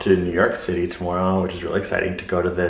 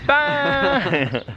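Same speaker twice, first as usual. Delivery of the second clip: playful bye. Pretend. Playful high farewell.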